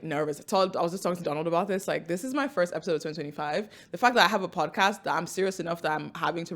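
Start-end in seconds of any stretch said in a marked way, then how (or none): none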